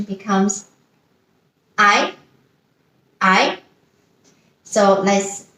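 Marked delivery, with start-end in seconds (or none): 1.8-3.6 ai rising; second tone ai. Shown as a demonstration. Teacher modelling second tone ai. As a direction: lift ai upward. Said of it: "If we try the second tone".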